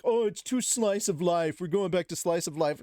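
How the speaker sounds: mocking whiny complainers. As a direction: sneer at the complainers. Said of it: "they're like".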